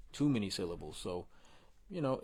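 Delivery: ordinary delivery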